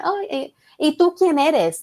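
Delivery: sizing up the rival with a snooty question; mock nosy. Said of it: "the way she said it though".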